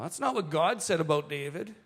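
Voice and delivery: ordinary talk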